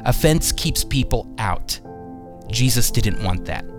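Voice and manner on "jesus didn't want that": normal talking voice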